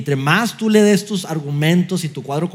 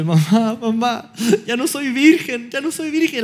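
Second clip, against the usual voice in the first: put-on high, wailing teenage girl's voice; crying teen girl; 6 semitones higher than usual. A teenage daughter wailing to her mother. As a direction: wail it like a distraught teen daughter.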